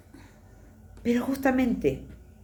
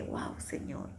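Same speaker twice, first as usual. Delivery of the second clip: awed whisper; 13 dB quieter, much slower than usual. Retold or imitated. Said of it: "y dije".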